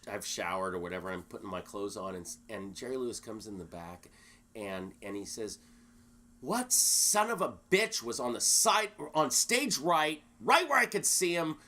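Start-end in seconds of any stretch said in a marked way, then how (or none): 6.4-11.6 loud mock rant, higher and slow; mock furious shout; imitating a furious yell. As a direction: bark it like an enraged star. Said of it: "he used so many expletives".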